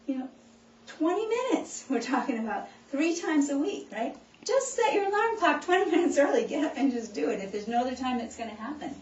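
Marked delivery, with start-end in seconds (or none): 4.4-6.4 laughing. Real, not acted. Laughs on this line.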